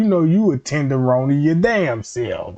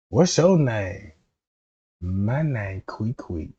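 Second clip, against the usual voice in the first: soft put-on voice; 7 semitones lower, 6 dB quieter, slower than usual. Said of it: "don't wanna hear that tone of the voice".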